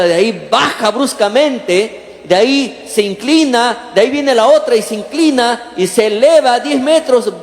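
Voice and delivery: normal talking voice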